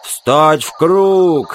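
loud, long-drawn command; bellowed order. Acted. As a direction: yell it out to the whole column. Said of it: "заорал Билл". (громкий приказ криком)

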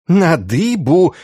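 high, drawn-out cry. A narrator's reading. Institoris demands the rack for the prisoner, high drawn-out cry. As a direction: cry the order out slowly and high. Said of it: "снова возопил Инститорис".

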